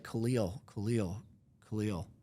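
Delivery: soft, measured repeated name; quietly steadying. Retold or imitated. Man stopping his panicked friend, quietly firm. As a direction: say the name gently to halt him.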